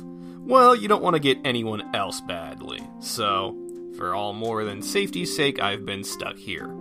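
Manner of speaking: normal talking voice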